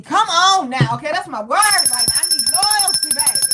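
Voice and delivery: high, loud, insistent; loud high plea for loyalty; emphatic declaration. Real, not acted. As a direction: belt it out like a demand. Passionate declaration of needs.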